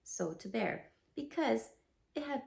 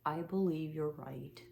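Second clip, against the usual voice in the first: lower, sighing and defeated; long sad sigh; 6 semitones lower than usual. Acted. Eeyore sighs to Pooh, admitting the loss sadly. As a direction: let it sink out on a sigh. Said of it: "with a long, sad sigh".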